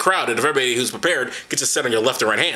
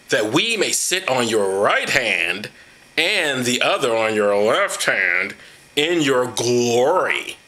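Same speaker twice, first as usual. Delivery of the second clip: slow reading; 4 semitones lower, much slower than usual. Acted. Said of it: "dramatic reading".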